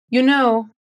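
you know left open at the end, sounding unfinished. Shown as a demonstration. Teacher showing you know with an open, unfinished ending. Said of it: "pegar bem essa entonação".

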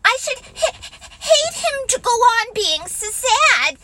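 high, hesitant little pig voice; a timid piglet unsure about the plan; doubtful Piglet. Acted. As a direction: stumble over it uncertainly. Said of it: "said Piglet doubtfully".

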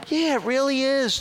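high-pitched emphatic yes; emphatic agreement. Retold or imitated. Dealership girl answering a caller's question, emphatic. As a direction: imitate a phone girl insisting it's true.

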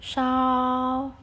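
"shao" held long, high and level; first tone. Shown as a demonstration. Teacher shows students the long tone, level "shao". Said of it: "panjang jadinya ya".

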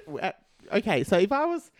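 ordinary delivery

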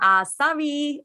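high-pitched attempted Geordie "sorry"; imitation Geordie accent. Host showing her old impression, imitation Geordie. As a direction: put on an amateur Geordie accent. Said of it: "when I used to do it".

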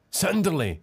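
high, urgent shout of a place name; panicked shout to the caller. A narrator's reading. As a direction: call it out over the phone. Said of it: "schrie Selma".

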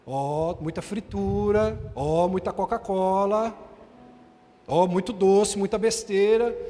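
leve imitação da esposa, em tom mais grave e lento; esposa advertindo. Retold or imitated. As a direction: enumere devagar como a esposa. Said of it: "A esposa não fala assim". (esposa listando o que o marido come, advertência lenta)

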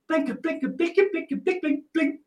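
high plinking sung notes; xylophone ringtone imitation. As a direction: mimic the iPhone xylophone ringtone. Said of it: "the xylophone ringtone".

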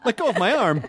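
laughing while arm is twisted; pained and laughing, arm held; arm twisted back. Real, not acted. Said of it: "you're hurting me".

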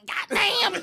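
loud, high mock curse; imitated mad outburst. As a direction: burst out like he just slipped. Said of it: "he mad about the boxes".

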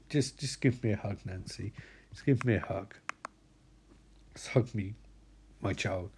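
very low, soft plea; acted tender murmur; subdued father. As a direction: quietly ask for comfort. Father asking daughter for a hug.

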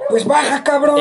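lightly acted parent ordering a kid downstairs; mock irritated comeback; imitated scolding parent. Retells parent's retort to his yelling.

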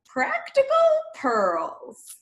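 drawn-out, hammed-up segment title; silly voice. Host introducing the show's segment to guests, in a silly voice. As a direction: announce it in a daft put-on voice. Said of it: "in this silly voice".

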